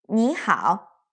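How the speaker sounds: "你好" run together without a pause; "你好" in a single breath; joined syllables. Shown as a demonstration. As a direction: say "你好" as one connected word. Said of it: "We'll say it like".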